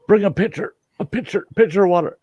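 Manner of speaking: high, fast frantic order; desperate demand. Retold or imitated. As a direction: rush the words out in panic. Begging waitress for water.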